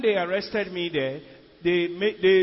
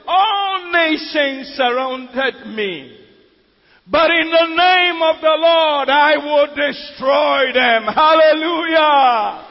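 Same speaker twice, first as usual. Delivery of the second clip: slow, loud preaching, pitched up; full force preaching; 5 semitones higher, 9 dB louder, much slower than usual. Acted. Preacher to congregation, loud slow preaching. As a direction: drive the verse home from the pulpit.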